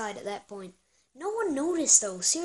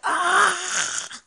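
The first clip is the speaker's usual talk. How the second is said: sharp high yelp; retold yelp of pain; 8 semitones higher, 8 dB louder than usual. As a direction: cry out at a sudden burn. Burned by the firework flicker.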